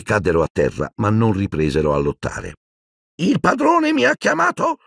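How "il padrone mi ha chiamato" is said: high, put-on croaky elf voice; Creature, croaking. Sullen elf answers his master's summons, croaking. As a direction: croak the question in a high pitch. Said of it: "gracchiò Creature".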